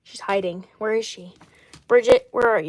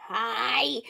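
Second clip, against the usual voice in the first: softer drawn-out mock greeting; imitated character voice; 7 dB quieter than usual. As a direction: briefly slip into a put-on voice. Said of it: "I'm not doing her voice".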